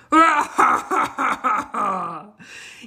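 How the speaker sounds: loud hearty lion laugh; a lion roaring with laughter; big loud laugh. Acted.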